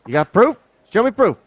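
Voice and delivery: high, loud, defiant protest; cornered crook loudly demanding proof; defiant challenge. Acted. Accused dealer challenging the accuser to show proof, defiant.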